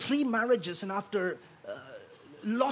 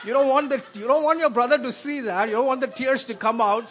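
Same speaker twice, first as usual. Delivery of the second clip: laughing; 2 semitones higher, 7 dB louder than usual. Real, not acted. Laughing, recalling hiding tears.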